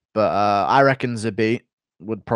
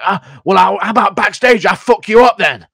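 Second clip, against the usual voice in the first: mock Bisping threat, higher and louder; 7 semitones higher, 7 dB louder than usual. Retold Bisping threatening backstage, hammed up. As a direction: snarl it like a fighter picking a fight. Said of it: "Bisping just went like".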